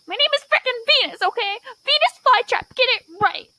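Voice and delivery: high, fast, insistent scolding; exasperated outburst. Acted. Venus correcting people on the name, exasperated.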